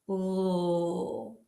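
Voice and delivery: sustained, isolated "L"; L sound. Shown as a demonstration. Teacher shows class where the tongue goes, held L sound. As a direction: hold "L" with tongue tip behind the top teeth. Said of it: "Start with the L sound".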